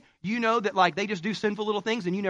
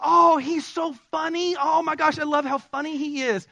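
gushing infatuated voice; 6 semitones higher than usual. Retold or imitated. Wife remembering dating days, mock dreamy gush. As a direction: coo like you're smitten. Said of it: "See, you thought".

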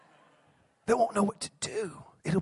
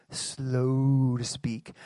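"slow to speak" drawn out very slowly and low; 10 semitones lower, 4 dB quieter, much slower than usual. Shown as a demonstration. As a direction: stretch "slow to speak" like a long yawn. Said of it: "drawing out slow".

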